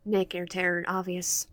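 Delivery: ordinary delivery, nothing marked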